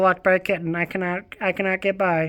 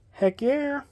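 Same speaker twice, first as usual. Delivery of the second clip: much higher, softer "Heck yeah"; squeaky high heck yeah; 10 semitones higher, 4 dB quieter than usual. Shown as a demonstration. Vlogger cheering about a Steve Martin book, high squeak. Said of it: "same words said twice".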